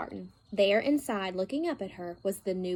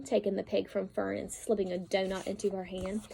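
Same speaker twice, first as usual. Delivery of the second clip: usual speaking voice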